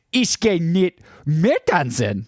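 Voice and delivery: high, slow, fluttery German line. Shown as a demonstration. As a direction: ich gehe nicht mehr tanzen in a high fluttery lilt. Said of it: "like, fluttery".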